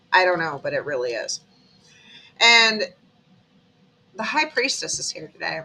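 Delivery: normal talking voice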